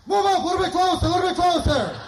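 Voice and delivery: high-pitched call to come back. Retold or imitated. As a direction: pitch it up like yelling down the block. Walkie-talkie kid calling brother back.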